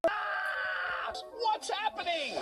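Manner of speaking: ordinary talk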